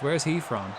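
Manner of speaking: ordinary delivery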